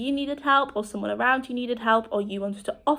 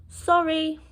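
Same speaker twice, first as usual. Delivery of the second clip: higher-pitched, apologetic demonstration; polite refusal; 5 semitones higher, much slower than usual. Shown as a demonstration. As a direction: turn down help apologetically, pausing between words. Demonstrates politely declining offered help.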